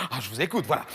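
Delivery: lightly voiced, low-pitched TV director. Le directeur répond au créatif. (directeur de télé, voix basse)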